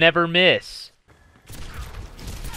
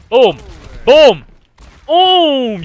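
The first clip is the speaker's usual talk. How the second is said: loud, high triumphant booms; excited shouts; 8 semitones higher, 10 dB louder, much slower than usual. Real, not acted. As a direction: shout each boom louder and higher. Celebrating hits in game.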